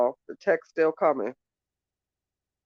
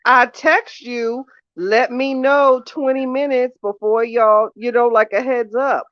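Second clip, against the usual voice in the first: exasperated reminder; 5 semitones higher, 5 dB louder than usual. Real, not acted. Arguing on phone.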